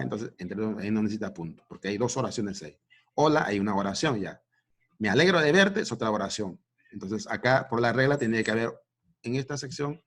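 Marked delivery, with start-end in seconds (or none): 3.1-3.4 a louder, higher "Hola" as an example; bright greeting demo. Shown as a demonstration. Teacher models a greeting for the class, brightly. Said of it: "demo greeting sentence". 5.0-5.8 higher and louder, a happy "Me alegro de verte"; cheerful demo line. Shown as a demonstration. Teacher reads an example sentence aloud, glad and bright. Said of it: "demo greeting sentence".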